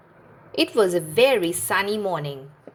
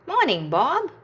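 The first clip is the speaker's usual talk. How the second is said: higher chirpy reply; machines greeting in chorus; 8 semitones higher than usual. A narrator's reading. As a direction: answer Bob together brightly. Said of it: "Wendy and the machines replied".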